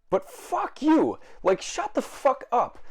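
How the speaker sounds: high, slow furious yell at the talkers; angry outburst. Real, not acted. Vlogger venting at theater talkers, furious high outburst. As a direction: spit it out in disgust. Ranting at annoying moviegoers.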